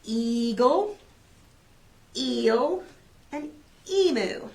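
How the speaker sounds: Eagle, eel, emu with a stretched long E; long E modelled. Shown as a demonstration. Teacher to preschoolers, modelling the long E clearly. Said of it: "Listen for little E's name".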